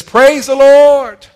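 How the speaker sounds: slow, high, very loud cry of praise; shouted praise. Acted. Preacher leading the church in praise, slow high shout.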